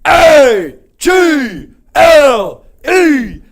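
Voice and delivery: loud chant, letters drawn out; shouted cheer. Acted. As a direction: shout it like a stadium crowd. Spelling out Eagles cheer.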